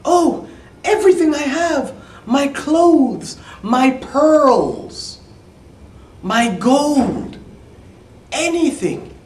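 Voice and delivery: high, loud, eager pleading; eager princess. Acted. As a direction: offer everything in a rush of hope. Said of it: "the princess said".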